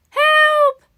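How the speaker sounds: high, loud, drawn-out cry; calling out for help. A narrator's reading. Paddington, stuck in the bath, calling out for help. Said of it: "Paddington tried calling out".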